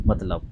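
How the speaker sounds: मतलब with a clear त, 'mat-lab'; correct pronunciation. Shown as a demonstration. Teacher repeats the word for students, correct pronunciation. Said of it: "इसका जो सही उच्चारण है".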